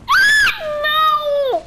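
high loud cry of dismay. Real, not acted. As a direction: cry out as you fall. Falls down.

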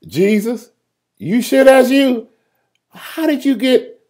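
high, drawn-out incredulous questioning; doubtful disciple. Acted. Doubter in the boat questions Jesus, high and incredulous. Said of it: "I would have probably said".

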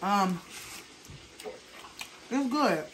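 eating between words; talking while chewing. Real, not acted. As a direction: mouth partly full. Seen 0: Side-on man forks pasta toward mouth between lines; chewing partly visible.

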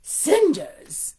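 loud, bossy command; bossy order. Acted. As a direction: order her around. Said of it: "Pecky ordered".

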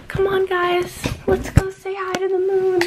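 rally the viewers to go see the moon; excited invitation. Real, not acted. Vlogger calling her viewers to come greet the moon, excited.

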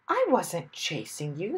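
gentle, humble protest. A narrator's reading. Airplane man explaining himself to cross Mister Wumble, humble. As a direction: excuse yourself meekly. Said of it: "airplane man said humbly".

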